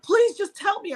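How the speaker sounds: high, urgent plea; frantic pleading. Retold or imitated. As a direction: imitate a sister begging for news. Acting out frantic sister pleading.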